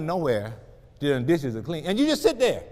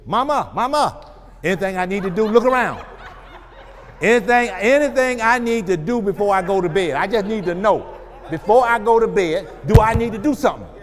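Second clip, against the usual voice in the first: lightly voiced eager child, a bit louder; 4 semitones higher, 6 dB louder than usual. Son eager to please mother before bed. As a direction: ask keen to please mama. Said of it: "he'll start looking for you".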